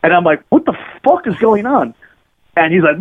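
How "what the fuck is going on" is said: high-pitched, imitated shocked demand; retold alarmed disbelief. Hungover guest to bloodied man, retold alarmed disbelief. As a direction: replay your startled question, pitched up high.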